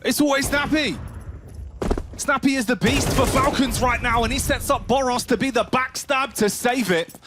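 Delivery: high, hyped praise of the player; excited. Real, not acted. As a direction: shout the star's name with joy. Casting a star player's streak.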